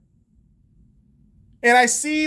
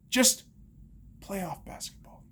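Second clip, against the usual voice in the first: whisper; 9 dB quieter, slower than usual. Real, not acted. Aside in rant.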